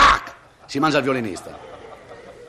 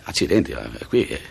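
doctor voice; 11 semitones lower than usual. Acted. Doctor examines the navel.

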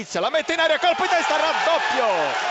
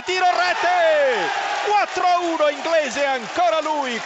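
goal shout; 5 semitones higher than usual. Real, not acted. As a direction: yell the goal. Goal call on radio.

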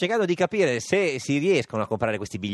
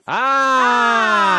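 high loud gasp of shock; shocked shout; 11 dB louder than usual. Real, not acted. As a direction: cry out at the price. Shock at price.

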